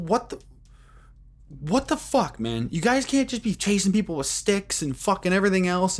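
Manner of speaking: high, incredulous outburst at the chasers; exasperated confrontation. Retold or imitated. Narrator scolds two men chasing a kid, high and incredulous. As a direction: call out the men, pitched high in disbelief.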